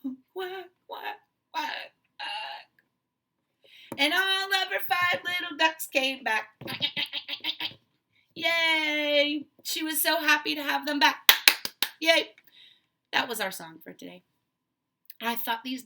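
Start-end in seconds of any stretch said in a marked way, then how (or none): 0.0-1.9 sad mother duck. Retold or imitated. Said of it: "Mother duck said". 4.0-12.3 louder, cheering ending; joyful cheer. Shown as a demonstration. Teacher cheering the happy ending to the class, louder.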